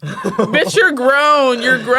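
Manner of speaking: loud, laughing indignation; amused, emphatic telling-off; incredulous scolding. Real, not acted. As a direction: call him out, half laughing. Mocking the server's joke.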